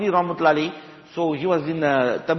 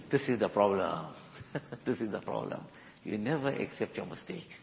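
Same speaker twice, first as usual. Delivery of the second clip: put-on low, soft voice of the old master correcting him; calm elder's rebuke; 10 semitones lower, 11 dB quieter than usual. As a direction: lower and softer, a gentle firm elder. Elder calmly rebukes angry disciple.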